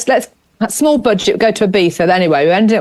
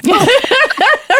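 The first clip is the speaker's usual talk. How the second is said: high bright burst of laughter. Real, not acted. Laughing at the message.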